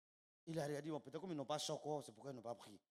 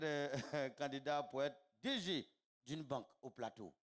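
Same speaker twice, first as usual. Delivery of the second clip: usual speaking voice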